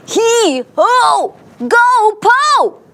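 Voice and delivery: high, loud chant; hammed-up high heaving cheer; crowd heave-ho. A narrator's reading. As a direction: call out like a team pushing together. Whole town heaving at the elephant.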